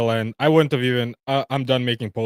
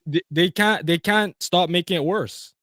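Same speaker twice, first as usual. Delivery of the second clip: ordinary delivery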